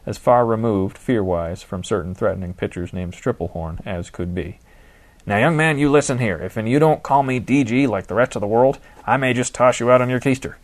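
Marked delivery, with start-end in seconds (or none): 5.3-10.6 playfully stern, louder warning; mock stern scolding. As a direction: wag a finger at the young man.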